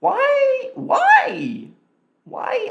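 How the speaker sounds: very high, loud squealed "why"; mock wail. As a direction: squeal it in exasperated falsetto. Mock exasperation at confusing listing.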